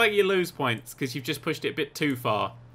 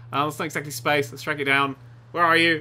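usual speaking voice

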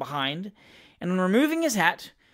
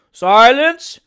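much higher, much louder roar; roared command; 5 semitones higher, 13 dB louder than usual. A narrator's reading. Attendants roaring at the crowd for silence, loud roar. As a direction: roar for silence over the mob. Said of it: "roared the mayor's attendants".

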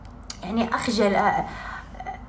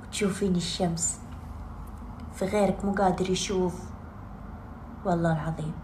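lower, soft sincere counsel; low tender reassurance; 5 semitones lower, 7 dB quieter than usual. Real, not acted. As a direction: speak gently, counting blessings. Soft gratitude advice.

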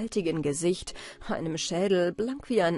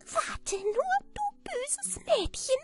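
very high, furious little man; thin squeaky screech. A narrator's reading. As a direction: screech a threat in a thin piping voice. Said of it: "kreischte mit seiner dünnen, piepsigen Stimme".

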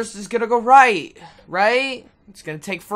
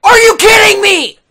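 high, loud disbelief at the keyboard; exasperated yell; 11 semitones higher, 10 dB louder than usual. Real, not acted. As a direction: yell at the broken controls. Keyboard stopped working.

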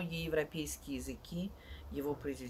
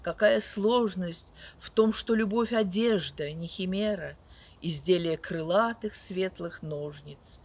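loud, sweeping poetry recital with big swells; dramatic poetry declamation; 6 dB louder than usual. Acted. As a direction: declaim each line like on stage. Reads poems aloud to audience.

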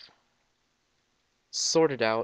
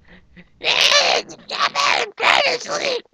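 loud high laugh; 10 dB louder than usual. Real, not acted. Bursts out laughing.

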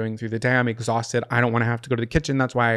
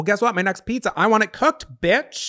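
indignant rant; 8 semitones higher than usual. Real, not acted. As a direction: demand it, fed up. Ranting about raw pizza.

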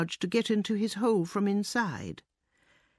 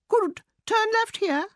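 very high-pitched mouse command; shouting; 14 semitones higher, 4 dB louder than usual. A narrator's reading. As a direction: call out directions in a high mouse voice. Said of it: "and to shout".

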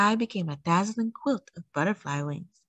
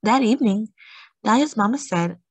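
plain reading voice

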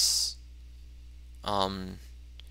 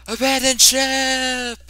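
very high, drawn-out loud yell; panicked captain's shout; 14 semitones higher, 9 dB louder, slower than usual. Acted. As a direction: shriek the order in mock panic. Acted order to flee ship.

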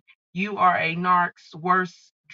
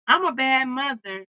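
ordinary talk

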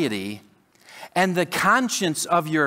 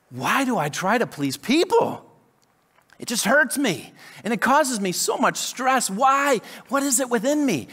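higher, pleading frustration that builds; exasperated inner cry; 6 semitones higher than usual. Retold or imitated. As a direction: plead with yourself, fed up and tired. Inner cry about people-pleasing.

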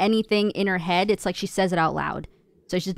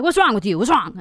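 imitating mom's loud questioning; loud, punchy mock demand; aggressive mom; 2 semitones higher, 6 dB louder than usual. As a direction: demand it like an alarmed Italian mom. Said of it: "tone of our voice has to be".

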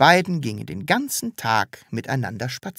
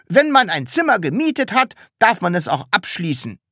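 raised, high-pitched retort; bold calling back; 8 semitones higher, 4 dB louder than usual. A narrator's reading. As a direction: call back with plucky defiance. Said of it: "rief Herr Taschenbier mutig zurück". (mutig zurückgerufen)

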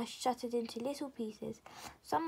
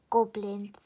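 ordinary delivery

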